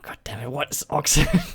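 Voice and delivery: exasperated whisper under the breath; a muttered whispered aside. Real, not acted. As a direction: whisper it, baffled and annoyed. Reading a puzzling question.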